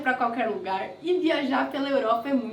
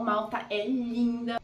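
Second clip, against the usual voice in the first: ordinary talk, nothing marked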